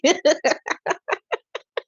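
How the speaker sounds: high, helpless laughter; tickled laugh. Real, not acted. Tickled by y'all, cracking up. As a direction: keep laughing high until it peters out. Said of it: "I'm cracking up".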